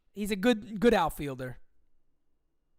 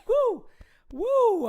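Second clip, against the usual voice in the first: high loud woo; excited whoop; 6 dB louder than usual. Real, not acted. Streamer venting rising agita, high loud whoops. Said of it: "Agita is building up".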